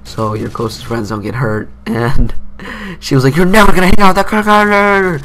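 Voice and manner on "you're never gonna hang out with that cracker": high, loud mock rant; pissed-off mom. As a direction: imitate a mother yelling a ban. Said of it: "She got pissed off".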